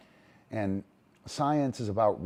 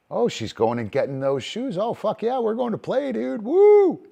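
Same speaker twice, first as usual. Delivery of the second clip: dog voice climbing much higher and louder into a whoop; thrilled dog; 12 semitones higher, 8 dB louder than usual. Retold or imitated. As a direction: let the dog explode with joy about playtime. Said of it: "The dog goes".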